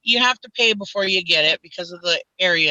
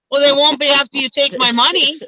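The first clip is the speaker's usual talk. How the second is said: sharp comeback; 7 semitones higher, 6 dB louder than usual. Retold or imitated. Snapping back at the clerk.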